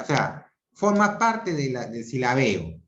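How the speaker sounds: ordinary talk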